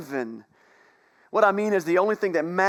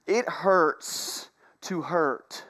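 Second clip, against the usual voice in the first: slow emphatic; much slower than usual. Shown as a demonstration. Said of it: "repeated for emphasis".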